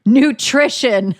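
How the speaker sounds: Nutrition drawn out slowly and pointedly; mocking the husband's odd reason; mocking emphasis. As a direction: drag out Nutrition like a punchline. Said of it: "one of those fucking reasons were".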